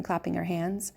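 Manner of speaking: usual speaking voice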